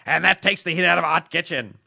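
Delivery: excited hockey announcer; Mike Lang impression. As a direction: call it like a lively play-by-play man. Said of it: "give us a little Mike Lang".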